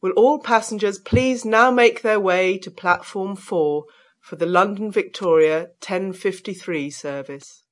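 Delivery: ordinary delivery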